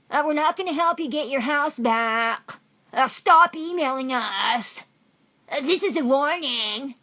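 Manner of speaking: high, loud, drawn-out caricature; mocking female officer. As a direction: mimic the officer in a loud, slow, high-pitched put-on voice. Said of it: "the female pig speaks, and it says".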